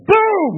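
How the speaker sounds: high, loud mock "boom"; sudden blast noise; explosion sound. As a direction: slam down a punishing boom. Imitating instant punishment.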